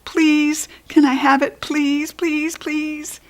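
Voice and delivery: high, sweet begging please; child begging with folded hands. Acted. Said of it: "folded my hands real begging".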